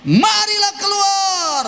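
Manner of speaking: slow, high, drawn-out call to come out; commanding call. Retold or imitated. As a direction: call the dead man out with authority. Preacher voicing Jesus calling Lazarus. (seruan memerintah yang lambat dan tinggi)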